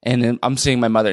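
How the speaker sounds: ordinary talk, nothing marked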